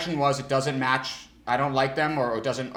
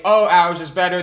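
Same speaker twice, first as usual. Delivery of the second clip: loud, high retort; mock loud comeback; mock fighting rep; 5 semitones higher, 10 dB louder than usual. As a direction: trash the competition. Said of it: "They'd fight and say".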